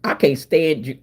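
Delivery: normal talking voice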